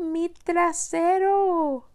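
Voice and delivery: high, slow howling wolf; wolf in pain. Acted. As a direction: howl like a scorched wolf. Wolf burns his bottom.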